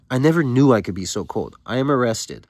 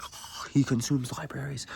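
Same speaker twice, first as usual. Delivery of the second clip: hushed, airy fake amazement; mock-awed whisper; 4 semitones higher, 8 dB quieter than usual. Onlookers marvelling at the well-read student, mock whisper. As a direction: whisper like an impressed bystander.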